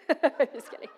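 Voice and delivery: laughing it off. Real, not acted. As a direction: chuckle through a quick take-back. Joke about daughter, laughing.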